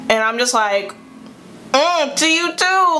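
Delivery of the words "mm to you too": higher, drawn-out sassy comeback; slow it down and pitch it up, sassily; mock retort. Camper answering a girl's grunt, mock sassy retort.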